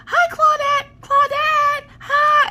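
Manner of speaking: put-on piercing high kid squeal; little girl shouting. Little girl greeting the bear by name, high-pitched shouting. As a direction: imitate a child calling out in a very high voice. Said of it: "she would start shouting the name out".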